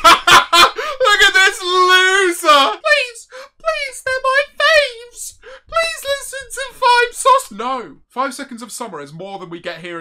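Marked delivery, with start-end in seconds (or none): 0.0-2.7 loud, high, laughing jeer; mocking laughter. Real, not acted. Streamer ridiculing a begging fan, laughing loud jeer. 2.7-7.5 very high, drawn-out whiny plea; mock begging fan. Streamer imitating a fan begging for a band, squealed mock pleading.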